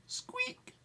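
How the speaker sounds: tiny high-pitched squeak; very high, small mouse voice. Acted. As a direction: squeak softly like a little mouse. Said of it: "And the mouse said".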